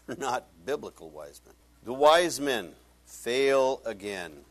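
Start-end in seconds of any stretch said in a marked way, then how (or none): 1.8-4.4 drawn out, each word weighted; slow emphatic punchline. Real, not acted. Preacher to congregation, slow weighty emphasis. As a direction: land the line slowly and loudly.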